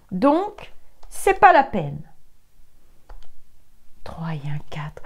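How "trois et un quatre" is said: counting in a whisper; hushed count of the cards. Real, not acted. Tarot reader counting cards under her breath, whispering.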